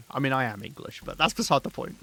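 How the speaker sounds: ordinary talk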